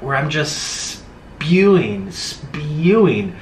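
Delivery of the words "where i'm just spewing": usual speaking voice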